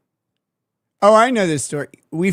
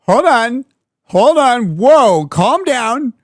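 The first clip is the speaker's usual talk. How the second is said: loud, raised-pitch "whoa, hold on"; play-acted emphatic interruption; mock urgent calming; 4 semitones higher, 6 dB louder, much slower than usual. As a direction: cut in loudly to halt a meltdown. Joking reenactment of calming the caller.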